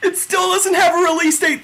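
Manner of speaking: very high, hysterical joy; overwhelmed fan screaming in disbelief; freaking out. Real, not acted. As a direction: squeal through laughter, near crying. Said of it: "how much I was freaking out".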